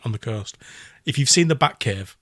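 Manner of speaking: ordinary delivery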